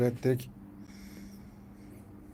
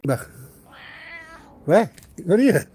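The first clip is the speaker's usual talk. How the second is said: ordinary talk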